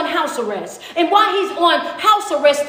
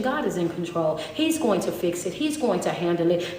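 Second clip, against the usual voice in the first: soft declaration; 8 semitones lower, 8 dB quieter than usual. Acted. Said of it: "Speak to that situation".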